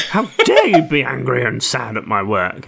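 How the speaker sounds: hammed-up offended doctor; imitated wounded pride; mock indignation. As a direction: act affronted, playfully.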